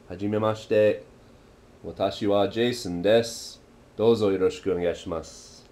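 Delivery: very polite. Shown as a demonstration. New arrival bowing slightly, very polite. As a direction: introduce yourself very politely, pitched low. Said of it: "That's being very polite".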